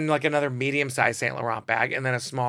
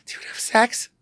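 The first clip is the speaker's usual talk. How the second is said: breathy hushed whisper, pitched up; whispered question; 10 semitones higher than usual. Retold or imitated. To him at lunch, a hushed whispered question. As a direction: lean in and whisper it like a secret.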